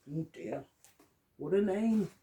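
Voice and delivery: usual speaking voice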